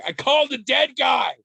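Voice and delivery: loud, high blurted confession; blurted shout. Retold or imitated. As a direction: yell it out, half laughing. Retold blurting to classmates.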